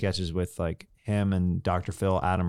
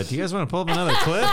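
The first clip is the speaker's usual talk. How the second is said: excited; 10 semitones higher, 6 dB louder, faster than usual. Retold or imitated. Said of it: "I'm like".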